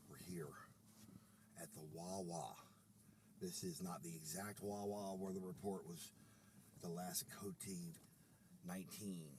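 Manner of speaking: very low, soft reporter voice, measured pace; hushed low on-the-scene report, deliberate pauses; mock field report. Reporter on quarantine day at the Wawa, low and solemn.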